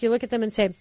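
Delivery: ordinary talk, nothing marked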